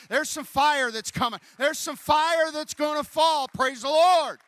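loud, rising preacher's cry; fiery preaching. Retold or imitated. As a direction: belt it like a revival sermon. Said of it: "He said".

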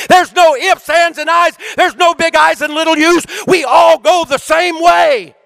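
high, loud shouted preaching; preacher shouting the verse to the congregation; preaching at full force. Acted. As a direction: thunder the promise out to the whole room.

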